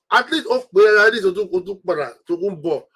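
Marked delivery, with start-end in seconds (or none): none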